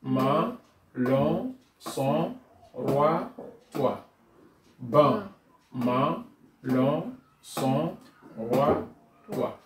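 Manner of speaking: syllables read slowly, one by one; syllable drill. Shown as a demonstration. Teacher reading syllables aloud with the class. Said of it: "On lit ensemble".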